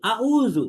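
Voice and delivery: আউযু with the throat sound said like a plain glottal a; mock-wrong আউযু, ain swallowed; wrong demo. Shown as a demonstration. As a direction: demo the mistake: আউযু with a plain hamza start. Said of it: "কিন্তু এটা ভুল".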